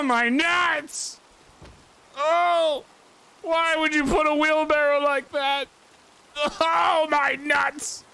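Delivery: high pained groaning; just hit in the groin. Acted. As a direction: wheeze in pain after a groin hit.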